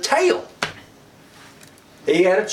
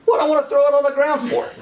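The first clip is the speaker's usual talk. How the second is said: higher, quick incredulous objection; balking, pitched up and hurried; mock protest; 7 semitones higher, faster than usual. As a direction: protest like you've been asked something silly. Acting Moses objecting to God.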